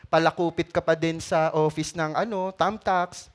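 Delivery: ordinary talk, nothing marked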